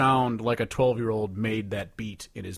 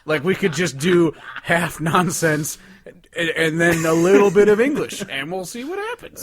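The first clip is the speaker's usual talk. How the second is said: louder, laughing voice of a clueless songwriter; mock songwriter; 6 semitones higher, 6 dB louder than usual. Mocking the songwriter to his co-host, in a loud laughing voice. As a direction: play the clueless writer pitching a dumb idea. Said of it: "imitating the songwriter's thinking".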